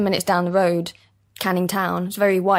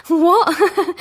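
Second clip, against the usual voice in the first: mock-indignant what; 11 semitones higher, 7 dB louder than usual. Acted reply in the retold exchange.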